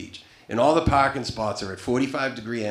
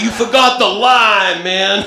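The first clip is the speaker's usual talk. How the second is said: much higher and louder, slow, laughing; loud mock complaint; 10 semitones higher, 15 dB louder, slower than usual. Chris shouting at Matt about the lime, loud. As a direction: bellow the complaint. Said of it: "He's like".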